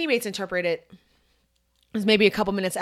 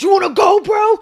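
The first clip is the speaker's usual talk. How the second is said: loud, high taunt to fight; challenging shout; 12 semitones higher, 10 dB louder than usual. Retold or imitated. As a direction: bark the challenge, much higher and louder. Shirtless opponent picking fight.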